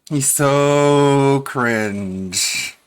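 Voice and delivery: very slow, high, loud sneer; drawn-out sneer. Real, not acted. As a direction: stretch every word in disgust. Drawn-out mocking jab.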